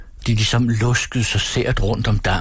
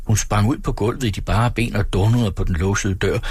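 plain reading voice

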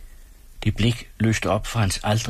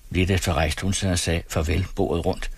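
plain reading voice

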